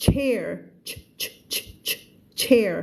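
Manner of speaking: CHAIR with bursts of CH shown alone; CH sound. Shown as a demonstration. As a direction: isolate the CH, then say CHAIR. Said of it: "ไม่ใช่แชร์นะ".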